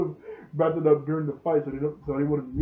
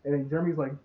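ordinary delivery

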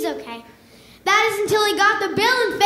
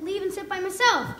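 ordinary delivery

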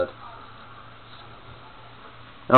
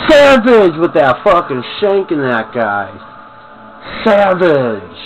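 loud high excitement; excited; 5 semitones higher, 4 dB louder than usual. Real, not acted. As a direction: hype up the kill. Excited praise of kill.

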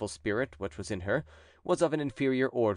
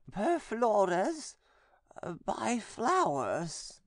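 much higher, slow, eerie possessed voice; possessed nun; 12 semitones higher, much slower than usual. A narrator's reading. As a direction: speak as the demon inside her, slowly. Possessed nun answers exorcist.

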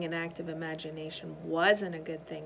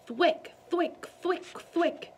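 punch sounds; 10 semitones higher, 4 dB louder, much slower than usual. Acted. Punching bag sound effect.

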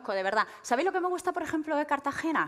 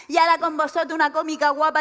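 loud, raised-pitch mock announcer; stage presenter; 5 semitones higher, 8 dB louder than usual. Man introduces a comedian on stage, loud mock presenter. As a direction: impersonate an over-keen emcee introducing an act. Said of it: "se sube el señor en el escenario y empieza".

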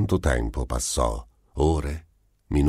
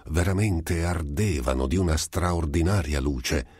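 plain reading voice

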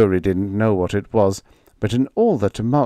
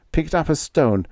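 usual speaking voice

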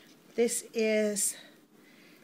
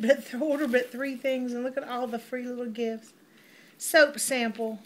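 ordinary talk

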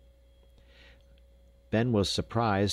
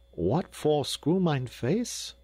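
put-on slow, sober Dutch boy, higher pitch; sober Dutch accent; 7 semitones higher, much slower than usual. A narrator's reading. Jacob answers Ben's teasing in a sober Dutch accent. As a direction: Dutch accent, asking it plainly and slowly. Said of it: "repeated Jacob soberly".

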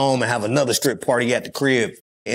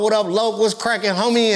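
higher, laid-back LA gangsta voice; lightly voiced West Coast homie; West Coast gangsta greeting; 8 semitones higher than usual. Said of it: "talking about".

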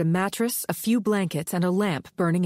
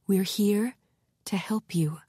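fading into a whisper; gently reassure, trailing into a whisper; softly; 2 semitones higher than usual. Acted. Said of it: "Maddie said softly".

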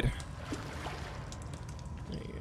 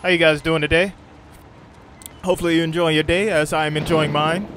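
loud, bright streamer hello; upbeat greeting; 5 semitones higher, 9 dB louder than usual. Real, not acted. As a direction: greet the chat with energy.

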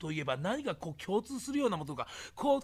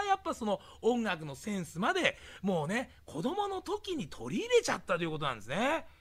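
ordinary talk, nothing marked

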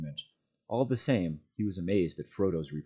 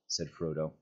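usual speaking voice

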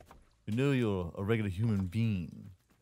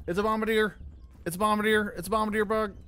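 startled alarm; 11 semitones higher than usual. Real, not acted. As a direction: call out the danger, pitched right up. Spots a dangerous bug in game.

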